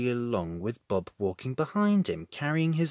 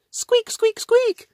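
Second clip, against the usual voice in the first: squeaky mouse squeaks; 8 dB louder, slower than usual. A narrator's reading. Boots squeaking.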